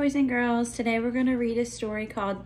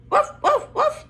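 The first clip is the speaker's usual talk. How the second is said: high, loud little woofs; puppy woofs; 4 dB louder than usual. Pretend. Teacher reading to children, high playful woofs. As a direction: bark like a tiny puppy. Dog sound in picture book.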